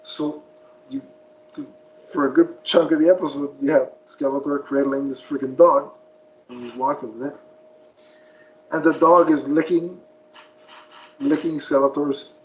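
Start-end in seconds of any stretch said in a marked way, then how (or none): none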